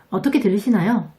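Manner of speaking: normal talking voice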